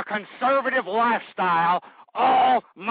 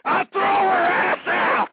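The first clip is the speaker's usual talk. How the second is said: slow, higher, louder furious shout; worked up; 11 semitones higher, 4 dB louder, slower than usual. Acted. Host declaring he'd throw his daughter out, slow angry shout. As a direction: spit out each word in anger. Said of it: "you're getting kinda worked up".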